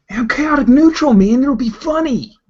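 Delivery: high, loud gleeful voice; goofy gnoll. Acted. Gnoll player explains her prank, high and gleeful. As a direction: squeal it like a prankster.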